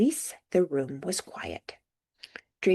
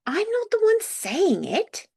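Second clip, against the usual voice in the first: high, loud British protest; indignant, drawn-out denial in a British accent; 5 dB louder, slower than usual. A narrator's reading. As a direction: protest innocence, pitched way up. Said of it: "he protested".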